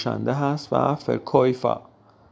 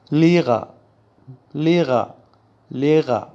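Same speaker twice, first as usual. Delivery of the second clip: loud, firm لیغا, stress on the first syllable; emphatic drill; 3 semitones higher, 6 dB louder than usual. Shown as a demonstration. Teacher drilling learners loudly on لیغا.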